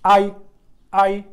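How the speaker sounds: आई with a sharp falling fourth tone; fourth tone demo. Shown as a demonstration. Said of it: "fourth tone के साथ इसको कैसे बोलेंगे?". (आई को चौथी टोन में तेज़ी से गिराकर बोलना)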